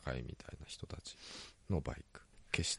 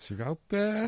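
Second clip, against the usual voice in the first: 茨城訛りを高い声で大げさに; mock Ibaraki dialect; 12 semitones higher, 4 dB louder than usual. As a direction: put on a thick rural Ibaraki voice. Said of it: "っつって".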